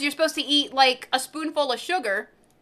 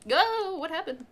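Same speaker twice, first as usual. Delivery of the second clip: hiccups; 4 semitones higher, 6 dB quieter than usual. Real, not acted. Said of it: "Hiccup, no".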